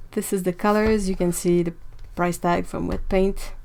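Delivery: ordinary talk, nothing marked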